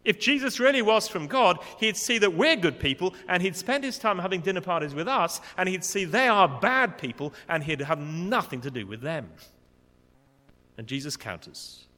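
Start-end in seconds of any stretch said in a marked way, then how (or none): 0.0-9.3 loud, high, indignant self-righteous reasoning; self-righteous Pharisees. Retold or imitated. Pharisees reasoning about who Jesus should dine with, self-righteous and loud. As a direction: insist you are the good people. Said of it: "that's the attitude of these Pharisees".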